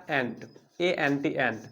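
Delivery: एंट spelled out letter by letter, then said slowly and low with an open "a"; slow spelled demo. Shown as a demonstration. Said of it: "सही से अगर उच्चारण करें".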